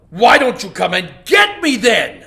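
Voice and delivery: loud shouted challenge; king daring the rival; roaring lion. Acted. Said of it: "roared the lion".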